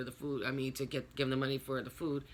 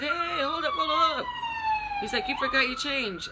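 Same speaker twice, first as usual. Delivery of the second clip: high, loud mock holler; imitated urgent shout to stop; calling out; 6 dB louder than usual. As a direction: call after someone driving away. Man calling after her driving off.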